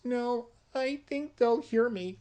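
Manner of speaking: put-on high, sweet, innocent little girl. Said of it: "very sweet, innocent".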